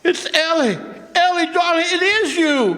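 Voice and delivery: high, joyful cry of recognition; bursting with relief and joy; overjoyed. Acted. Mac sees his wife alive.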